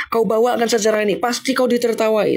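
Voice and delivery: normal talking voice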